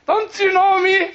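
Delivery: mock-offended celebrity, high and loud; indignant self-importance. Retells a celebrity demanding recognition.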